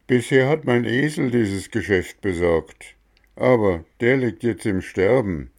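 lightly voiced gardener; Mexle's voice.

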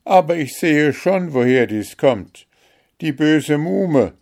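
storybook queen's indignation; angry accusation. A narrator's reading. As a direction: denounce the culprit with emphatic heat. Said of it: "der Zorn rötete ihre Wangen".

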